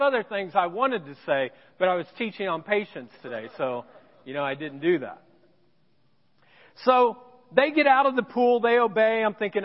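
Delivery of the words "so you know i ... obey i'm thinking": normal talking voice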